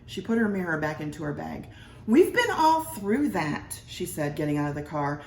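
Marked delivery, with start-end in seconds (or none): 2.1-3.8 higher, slow and final wife; impatient wife. A narrator's reading. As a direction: shut the topic down like a weary wife. Wife dismissing him.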